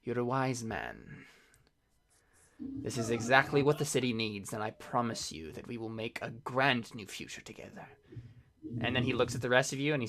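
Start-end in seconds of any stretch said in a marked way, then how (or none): 0.0-7.9 hushed, measured promise, lightly acted; softly seal the pact with him; solemn negotiator. Negotiator praising Sergio for saying yes, quietly solemn. Game master voices the approving negotiator.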